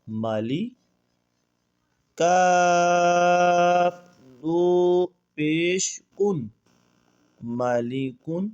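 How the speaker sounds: slow drawn-out recitation of letters; spelling drill. Shown as a demonstration. Teacher slowly spelling Quran letters for students, drawn out. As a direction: chant each letter and vowel slowly.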